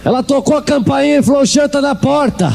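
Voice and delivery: mock alarm. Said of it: "ele falou".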